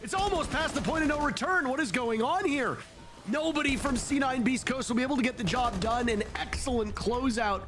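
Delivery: live esports call, pitch climbing to the climax; excited play-by-play. Real, not acted. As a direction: drive the round to its finish.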